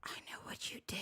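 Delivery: soft eerie whisper of a ghost; creepy whisper. Pretend. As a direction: whisper it like a haunting spirit. Creepy voice for the ghost-seeing cat.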